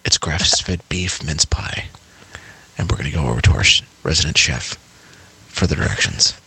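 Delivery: low, hushed public-radio parody; mock a soothing radio host, pitched well down with long pauses; calm NPR host. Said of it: "in the calm voice".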